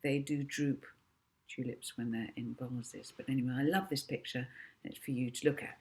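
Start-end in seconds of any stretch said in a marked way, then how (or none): none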